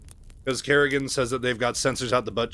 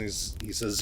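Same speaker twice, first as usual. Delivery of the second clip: usual speaking voice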